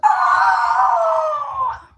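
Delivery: very high, loud startled yelp; startled shout. Retold or imitated. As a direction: blurt it out like a man who just got shocked. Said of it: "He's like".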